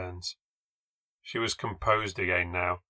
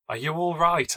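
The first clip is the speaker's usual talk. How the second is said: high-pitched, moderately raised call; a mild narrated shout, pitched up; concerned shout; 7 semitones higher than usual. A narrator's reading. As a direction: call out to check on someone hurt. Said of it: "I shouted".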